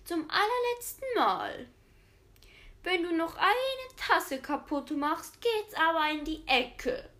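a grandma at the end of her patience; enraged. A narrator's reading. Grandma threatens Fritzchen after the second broken cup, furious. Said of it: "entzürnt".

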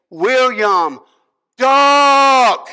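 loud, stretched-out cry to a batter; shouted warning. Retold or imitated. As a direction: yell a danger warning across the ballfield. Coach calls out a warning.

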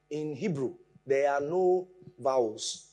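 ordinary talk

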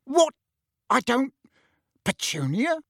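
high, halting questions; bewildered. Acted. Uncle asking his silent wife, bewildered. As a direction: stumble from question to question.

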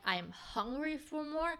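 ordinary talk, nothing marked

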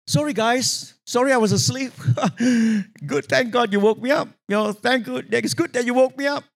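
light, high-pitched mock apology; imagined casual Jesus. Jesus joking to the disciples on waking, imagined casual apology. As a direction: play it as a chuckling, sheepish thanks. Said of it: "You'd expect Jesus to say something like that".

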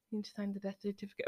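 quick, soft, flat doctor voice; quiet cold clinical doctor voice. Retold or imitated. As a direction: rattle it off coldly and quietly. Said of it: "the doctor like".

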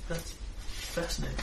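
hushed intrigued murmur; murmured fascination. Acted. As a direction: say it under the breath. Wizard NPC muses again.

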